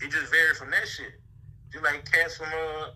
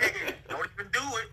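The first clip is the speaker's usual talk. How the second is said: mock warning; 5 semitones higher, 4 dB quieter, faster than usual. Repeating the warning, mocking.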